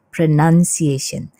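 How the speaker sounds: pronunciation said slowly, "pre-nan-siation"; slow, careful model of pronunciation; correct pronunciation. Shown as a demonstration. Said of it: "ini adalah pronunciation".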